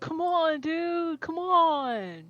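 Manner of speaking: high, whiny nagging; whiny pleading. Acted. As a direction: beg over and over, high. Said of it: "how annoying I can be with this".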